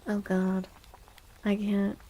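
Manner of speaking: ordinary talk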